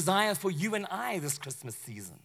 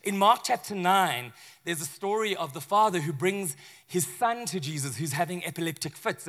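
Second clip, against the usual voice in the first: usual speaking voice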